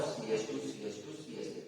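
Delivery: low, soft spelling-out of a formula; writing aloud. Real, not acted. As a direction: murmur each group as you write it. Writing carbons aloud on board.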